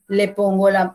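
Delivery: ordinary talk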